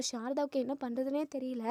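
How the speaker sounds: plain reading voice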